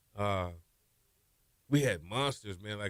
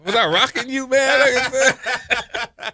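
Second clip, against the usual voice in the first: high, loud mock indignation; laughing banter; 11 dB louder, slower than usual. Real, not acted. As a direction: tease loudly, voice shooting up with laughter. Joking banter about co-host's age.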